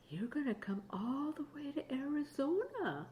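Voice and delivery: hushed excited whisper. Acted. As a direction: whisper the good news. Playful whisper to grandchild.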